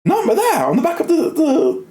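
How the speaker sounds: high, fast, excited realisation; squeaky high excitement, slowing at the end; excited discovery. Retold or imitated. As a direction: blurt it out like you've just cracked the code. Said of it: "and I was like".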